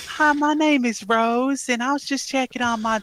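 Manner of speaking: hammed-up Southern mom voice; fake Southern woman. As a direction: put on a high Southern woman's voice. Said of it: "every woman voice you do a Southern one".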